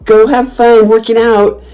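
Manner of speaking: high, slow mock cheering call; obnoxious taunt. Heckling the couple leaving the restaurant, obnoxiously. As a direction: stretch out a sing-out send-off to needle them. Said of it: "It's just being obnoxious".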